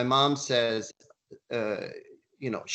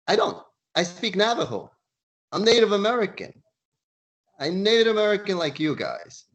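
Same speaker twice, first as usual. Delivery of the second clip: indignant retort; 8 semitones higher, 5 dB louder than usual. Retold or imitated. Johnston retorts to teasing Marines.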